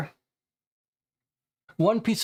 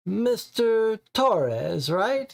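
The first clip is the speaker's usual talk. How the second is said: "right?" stressed, much higher and drawn out; slow "Mister Torres", then lean hard on "right?"; emphasis on right; 6 semitones higher, much slower than usual. Acted. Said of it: "putting emphasis on the last word".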